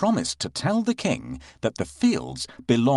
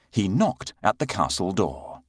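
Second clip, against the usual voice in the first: usual speaking voice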